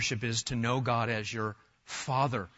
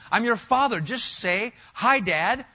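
higher and louder, a dad cutting in; exasperated dad; 7 semitones higher, 5 dB louder, slower than usual. Pretend. Father telling his child to just say hi, raised and exasperated. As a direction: break in on the kid, half fed up and half fond. Dad answering pestering kid.

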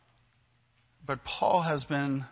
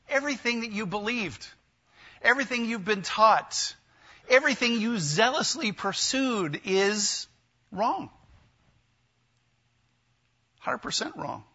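higher, pressing each everything to land on wrong; emphatic declaration; 7 semitones higher than usual. Retold or imitated. Jesus' voice confronting Saul, higher emphatic declaration. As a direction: hammer home that it was all wrong.

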